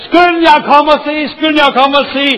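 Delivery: loud, high, excited calling out; shouting. Retold or imitated. Builder foreman calls his men to come and see, loud and excited. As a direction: call everyone over, loud and thrilled. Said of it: "og så råber han".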